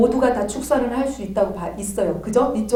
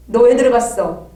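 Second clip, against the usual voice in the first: louder demanding question; stern interrogation; 7 dB louder than usual. Retold or imitated. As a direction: interrogate the spirit. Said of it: "그래서 막 이제".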